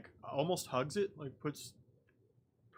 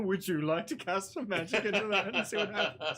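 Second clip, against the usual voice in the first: louder, laughing teasing echo; laughing repeat; 5 semitones higher, 4 dB louder than usual. Acted. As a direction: echo the line back, laughing. Host repeats the suggestion, laughing.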